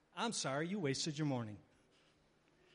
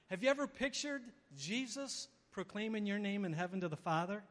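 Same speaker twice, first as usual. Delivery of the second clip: ordinary delivery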